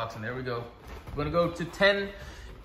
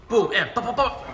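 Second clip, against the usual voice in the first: excited; 7 semitones higher, 6 dB louder than usual. Real, not acted. Coach hyping punches mid-round.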